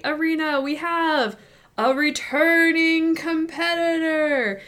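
very slow, high, stretched-out fighter intro; ring announcer. Acted. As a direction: hype the returning champion. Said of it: "And then he's like".

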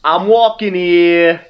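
slow, loud New York bark; hammy New York cabbie; Brooklyn accent. Pretend. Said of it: "thick Brooklyn accent".

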